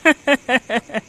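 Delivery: quick, gloating chuckle; mocking laugh. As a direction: laugh at someone's expense. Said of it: "हंसी मजाक करने लगे". (मज़ाक उड़ाती हंसी)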